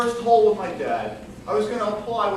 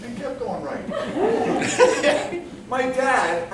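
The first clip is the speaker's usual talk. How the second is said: usual speaking voice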